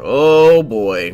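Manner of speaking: put-on big drawn-out "oh boy"; loud dismay. Narrator discovering a cryptic leather book, loud dismay. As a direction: let it burst out loud in disbelief. Reacts to finding magic book.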